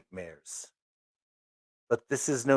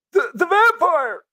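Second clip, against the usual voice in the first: high, loud, slow terrified cry; terrified scream; 13 dB louder than usual. Acted. Alfredo spotting the vampire in the dark wood, screaming. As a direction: shriek "the vampire" in horror.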